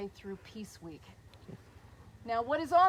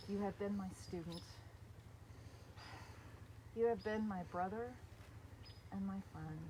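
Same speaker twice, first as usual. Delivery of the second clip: softer, tearful tribute to a brother; choked up; 4 semitones lower, 8 dB quieter than usual. Real, not acted. Honouring her brother at court, softly tearful. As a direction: voice thick with held-back tears. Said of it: "And now I'm gonna cry".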